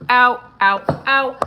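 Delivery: sharp loud cries of pain. Real, not acted. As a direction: yelp from a burnt hand. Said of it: "I just burned my hand".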